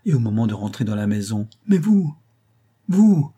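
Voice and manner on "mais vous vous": high, loud worried call; urgent call. A narrator's reading. Host calling to Maggie left standing, loud high worried call. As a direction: call out to her in alarm. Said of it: "disait-il".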